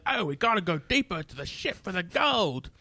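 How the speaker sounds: drawling celebrity impression; Michael Caine. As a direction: stretch it out like a hammed-up Cockney actor. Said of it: "stay in Michael Caine character".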